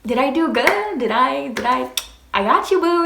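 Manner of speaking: high, eager mock-boyfriend voice; proud boyfriend impression. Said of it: "homeboy's over here smiling at me like".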